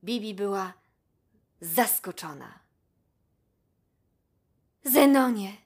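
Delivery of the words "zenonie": louder, higher, touched address; moved. A narrator's reading. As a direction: call his name, deeply moved. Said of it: "zwróciła się do niego wzruszona Bibi". (wzruszona, głośniej i wyżej)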